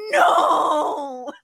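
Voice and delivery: cringing no, half-shouted; mock yell into pillow. Retold hiding face, yelling no.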